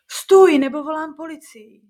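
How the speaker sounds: loud, high cry of stop; shouted threat. Acted. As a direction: yell to stop the creature. Grandmother threatens the creature.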